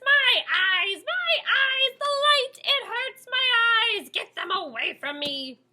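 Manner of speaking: high, loud wailing mole; pained yelps. A narrator's reading. Mole blinded by fireflies, wailing high and loud. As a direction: howl it as the light stings. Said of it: "yelps Odious".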